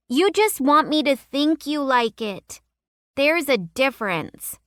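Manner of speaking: put-on high knowing young girl; a girl setting him straight; little girl voice. A narrator's reading. Girl character scolding boy.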